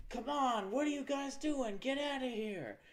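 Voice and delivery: mock panicked movie character. Movie character urging the crowd to flee, mock urgent yell. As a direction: holler it like an overacted film extra. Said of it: "this one character who's like".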